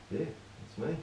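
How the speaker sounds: ordinary talk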